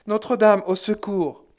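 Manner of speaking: high, loud desperate plea; cry for help. Retold or imitated. D'autres dans la foule implorent Notre-Dame, cri de détresse. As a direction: call out in terror for help. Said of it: "D'autres criaient".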